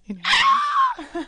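laughing shout. Real, not acted. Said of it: "pinpoint the moment that his heart breaks".

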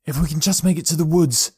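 a touch of desperate hope; urgent boy's thought. A narrator's reading. Boy races for the woods, urgent thought.

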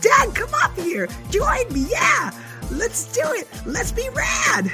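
very high shouted rap; excited shout. Acted. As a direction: hype up your dad. Child shouting rhyme.

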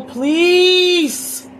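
loud, high, stretched out please; angry shout. Real, not acted. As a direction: yell it across the house. Mother shouting at son.

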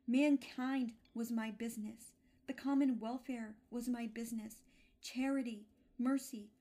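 loud, impassioned lament; a ghost declaiming his regret, loud and anguished; anguished crying out. A narrator's reading. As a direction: wring every line out of remorse. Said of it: "cried the ghost, wringing his hands".

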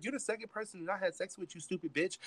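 high-voiced retold hurt and anger; reenacted tearful anger; crying pissed. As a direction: accuse them, upset and pitched high. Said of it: "crying pissed".

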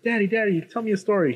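put-on high-pitched pleading child voice; little daughter. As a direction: imitate a little girl asking Daddy. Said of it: "his daughter puts on the hat".

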